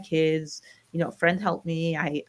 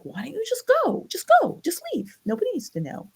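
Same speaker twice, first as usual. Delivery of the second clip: put-on much higher, coaxing older woman's voice; urging coworker; 13 semitones higher than usual. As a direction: push her gently to just go, pitched up high. Older coworker urging her to leave.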